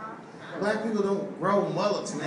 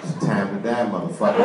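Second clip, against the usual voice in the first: low menacing threat; 8 semitones lower than usual. Retold or imitated. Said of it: "He said".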